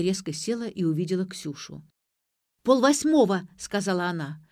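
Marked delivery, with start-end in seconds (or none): 2.6-3.5 high, loud exclamation; loud announcement. A narrator's reading. Girl waking her sleeping friend, loudly. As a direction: announce the time like an alarm. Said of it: "заорал кто-то над моим ухом".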